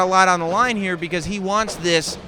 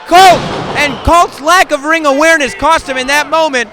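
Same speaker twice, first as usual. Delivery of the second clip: excited play-by-play; 7 semitones higher, 7 dB louder than usual. Real, not acted. Commentator calls a suplex.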